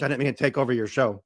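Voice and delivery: usual speaking voice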